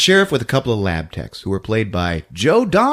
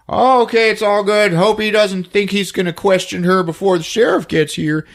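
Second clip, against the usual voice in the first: higher, anxious father trying to stay calm; higher, fretful small-town dad voice; nervous dad voice; 9 semitones higher than usual. Acted. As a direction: play a jittery dad pretending all is fine. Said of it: "he's like".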